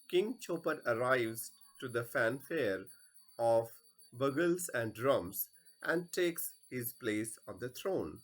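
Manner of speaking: ordinary delivery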